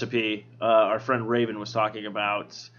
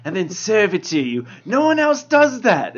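lazy know-it-all barista voice; bored ironic hipster; 3 semitones higher than usual. Acted. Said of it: "that's my hipster voice".

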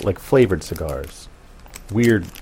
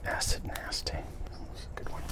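soft unvoiced whisper; 18 dB quieter, slower than usual. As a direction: hush it to yourself. Whispered aside on cigar.

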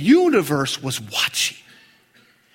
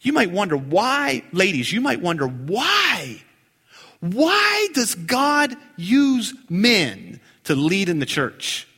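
ordinary delivery

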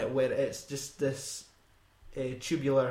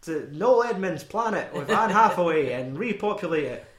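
put-on terrible southern accent; 4 semitones higher, 7 dB louder than usual. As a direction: ham up a cowboy accent. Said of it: "That's enough butchery".

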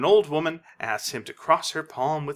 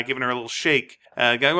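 usual speaking voice

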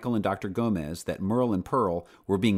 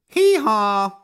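much higher, much louder donkey hee-haw; a big braying call from the old donkey; 12 semitones higher, 10 dB louder than usual. Acted. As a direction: let rip a full donkey bray. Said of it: "Dusty brayed".

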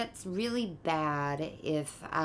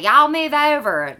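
loud Southern twang, y'all stretched; a loud put-on Southern accent, louder and higher; southern drawl; 10 semitones higher, 12 dB louder than usual. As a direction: bark it out in a thick Southern drawl. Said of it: "if you hear me say".

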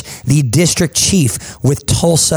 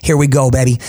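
normal talking voice

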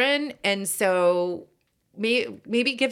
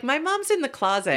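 put-on kid voice; 6 semitones higher than usual. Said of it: "What if my kid is like".

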